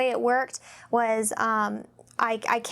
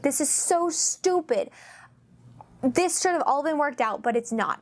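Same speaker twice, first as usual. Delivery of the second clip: getting mad; 3 semitones higher than usual. Retold or imitated. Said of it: "I was getting mad".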